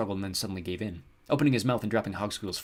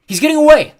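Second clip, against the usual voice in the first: very high, loud cry; urgent full yell; alarmed shout; 21 dB louder than usual. A narrator's reading. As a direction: yell that someone is escaping. Jared alarmed, goblin escaping.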